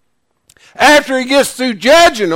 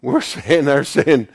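ordinary talk

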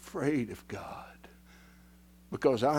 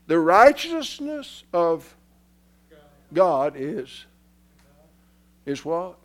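loud, raised voice with big pauses; emphatic preaching; 5 semitones higher, 11 dB louder than usual. Real, not acted. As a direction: hammer it out phrase by phrase. Preaches at full force.

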